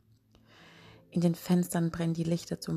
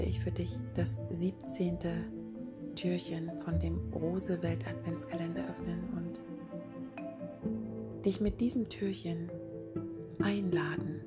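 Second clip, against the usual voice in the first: right in your ear; 5 dB quieter than usual. Acted. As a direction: lean close and murmur gently. Podcast intro over music.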